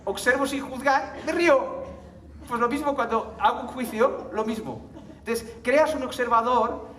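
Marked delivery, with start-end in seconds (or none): none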